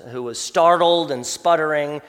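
ordinary delivery

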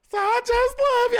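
mock gushing in a high squeal; exaggerated, high-pitched cutesy adoration; fawning old lady. Host mocking a woman fawning over morning show hosts, gushing falsetto. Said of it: "she's just fawning over people".

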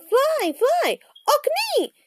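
high-pitched bird cries; raven calling. A narrator's reading. Annie urging Jack to fly, high calling. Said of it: "she called".

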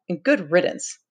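mildly scornful dismissal; a touch of contempt; contemptuous. A narrator's reading. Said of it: "said Jack contemptuously".

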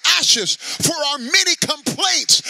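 loud, high, crying out in prayer; fervent prayer. Real, not acted. Preacher crying out to God for the church. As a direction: plead with God for repentance.

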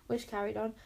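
usual speaking voice

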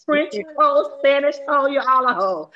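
hammed-up brash auntie; playful impression of a brassy mom; mock rant. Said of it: "She was so racist in that movie".

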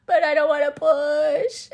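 loud, high, drawn-out whine; mock tantrum, stretching the words; retold wailing protest. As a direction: whine it like a child refusing. Retold louder protest to the Lord.

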